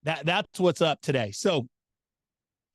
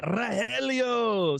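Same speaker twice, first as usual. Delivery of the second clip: drawn-out call; 6 semitones higher, much slower than usual. Real, not acted. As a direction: stretch the name out slowly, calling across. Calls again, unsure he's heard.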